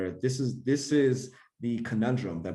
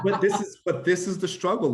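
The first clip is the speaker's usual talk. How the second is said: higher and louder, laughing through the words; 9 semitones higher, 6 dB louder than usual. Real, not acted. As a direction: laugh it out, amused. Laughing after shared joke.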